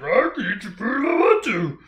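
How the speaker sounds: high, put-on little creature squawk; bratty little gremlin talking back. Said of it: "And he's like".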